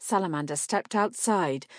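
ordinary delivery

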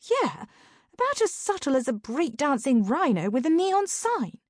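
high-pitched sarcastic sidekick; Sabrina. A narrator's reading. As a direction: sardonic aside in a high character voice. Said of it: "Sabrina muttered".

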